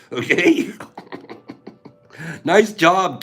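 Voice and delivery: ordinary talk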